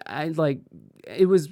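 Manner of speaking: normal talking voice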